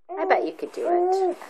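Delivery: encouraging. Real, not acted. Encouraging toddler.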